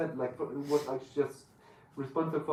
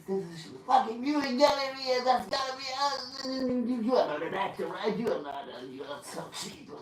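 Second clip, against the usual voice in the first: high, far-off put-on voice of a raging director; mock the director losing his temper, pitched way up; angry director impression; 9 semitones higher than usual. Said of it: "was that meant to be an English accent".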